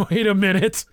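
talking through giggles; laughing. Real, not acted. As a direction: laugh while you speak. Cracking up while reading.

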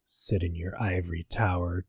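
low, soft menacing phone voice; imitating the creepy caller, low and soft. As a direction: loom over them in a low hush. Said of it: "he said something like".

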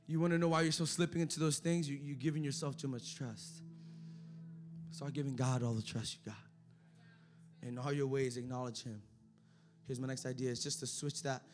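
low, soft intimate sermon voice; hushed preaching. Acted. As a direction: lean in and speak quietly to them.

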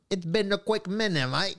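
lightly put-on Aussie twang; hint of an Australian accent; bad Australian accent. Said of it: "You do a really bad Australian accent".

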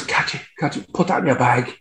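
hushed, pretending to be secretive; stage-whisper like a sneaky thief; mock furtive whisper. Retold joke of furtive stealing.

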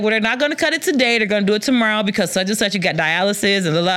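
fast, breezy patter; a quick, careless run of excuses; offhand rattling on. Retold or imitated. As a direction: keep the lie rolling casually. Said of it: "I was like".

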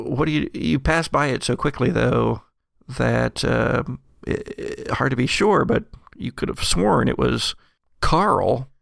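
ordinary talk

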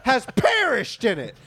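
high, slow, stretched-out indignation; mock outraged disbelief. Homeowner confronting firefighter over the lost family, outraged.

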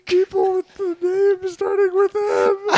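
very high falsetto voice; parent back from the dead. Acted. Revived parent explaining things to Matthew, falsetto parent. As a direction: complain in a piping falsetto.

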